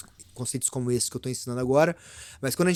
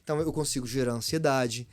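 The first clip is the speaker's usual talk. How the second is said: normal talking voice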